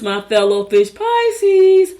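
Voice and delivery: high, loud, drawn-out cheer; celebratory announcement. Real, not acted. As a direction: stretch the last word out high and loud. Hailing own sign in horoscope list.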